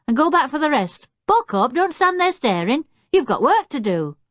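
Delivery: high-pitched, chivvying command; giving orders. A narrator's reading. Jeremy hurries the chimpanzee back to work, chivvying. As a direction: chivvy a dawdling helper along.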